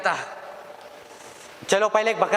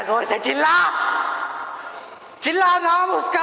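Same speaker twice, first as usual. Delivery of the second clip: shout; 10 semitones higher than usual. Shown as a demonstration. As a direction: bellow the name at full force. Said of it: "ऐसे चिल्लाना".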